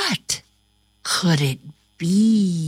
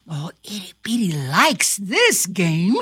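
ordinary delivery, nothing marked

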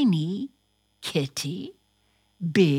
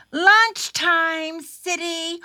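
plain reading voice

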